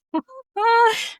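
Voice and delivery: sudden high laugh at herself; real burst of laughter. Real, not acted. Laughing at herself for patting an invisible horse, real laugh. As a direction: break into a bright real laugh.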